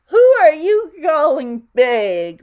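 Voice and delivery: high, booming amused retort; laughing Mister Greedy. A narrator's reading. Mister Greedy answers Little Miss Bossy, loud amused retort. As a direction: throw the question back very loudly. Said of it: "laughed Mister Greedy".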